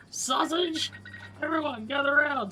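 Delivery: thrilled shout across the square; excited calling out. Acted. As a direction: call the whole village over. Calls the village out.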